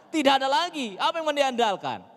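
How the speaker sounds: high, loud preacher's challenge; exclaimed rhetorical outcry. Real, not acted. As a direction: cry it out to the congregation. Exclaims to congregation over total loss. (seruan retoris bernada tinggi)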